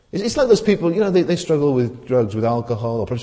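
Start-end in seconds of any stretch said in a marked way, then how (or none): none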